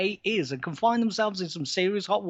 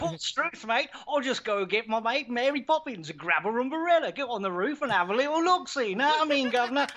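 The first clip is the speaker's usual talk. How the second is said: higher-pitched cartoon Cockney patter; hammed-up Cockney accent; 5 semitones higher than usual. Brit obliging Americans asking about Big Ben, in mock Cockney. Brit hams up London stereotype on request.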